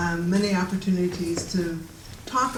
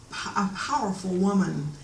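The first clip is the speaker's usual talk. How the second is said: ordinary talk, nothing marked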